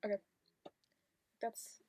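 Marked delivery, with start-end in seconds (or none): 0.0-0.2 deadpan, unimpressed okay; emotionless. Acted. Showing how they react to everything, emotionless. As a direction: acknowledge it with no reaction at all. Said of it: "I react to everything just like".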